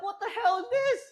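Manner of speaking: very high squeal of disbelief; incredulous outcry. Retold or imitated. Man reacting to being yelled at unfairly, incredulous high cry. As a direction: cry it out high, totally baffled. Acted disbelief after her insult.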